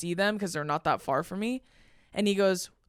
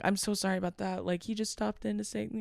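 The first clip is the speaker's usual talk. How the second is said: soft retold apology, much quieter; apologetic; 8 dB quieter than usual. The people shooting apologize to the performer, softly apologetic. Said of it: "apologized to me".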